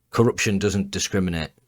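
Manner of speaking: usual speaking voice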